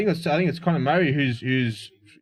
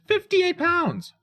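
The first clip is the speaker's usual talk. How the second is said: much higher, slow, disbelieving; outraged disbelief; much slower than usual. Real, not acted. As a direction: stretch it out, shocked at the price. Repeats price in disbelief.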